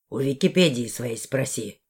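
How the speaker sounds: low, grudging male character; sulky grumble. A narrator's reading. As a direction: grunt it out low. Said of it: "буркнул он".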